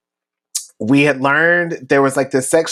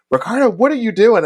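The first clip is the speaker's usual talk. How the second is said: mock angry coach; 9 semitones higher, faster than usual. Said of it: "like, a whole temper tantrum".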